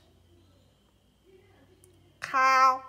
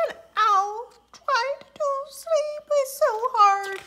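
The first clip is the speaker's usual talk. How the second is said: weary owl; 13 semitones higher, 8 dB louder than usual. Acted. Tired owl complains.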